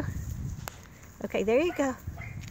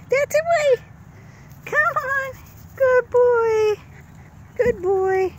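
puppy talk; 12 semitones higher, 5 dB louder, slower than usual. Real, not acted. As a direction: coo at the puppies up high. Praising puppies, high-pitched.

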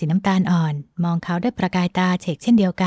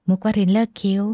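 usual speaking voice

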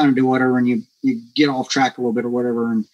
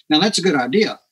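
normal talking voice

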